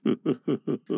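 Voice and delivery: soft, hollow chuckle; hollow laugh. A narrator's reading. Hook laughs at his own escape, soft and hollow. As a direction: laugh softly without mirth. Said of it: "but in a hollow way".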